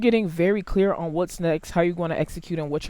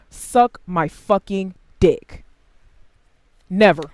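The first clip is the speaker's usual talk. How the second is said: slow defiant retort; 6 dB louder, slower than usual. Acted.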